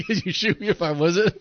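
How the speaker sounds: high laughing repeat. Real, not acted.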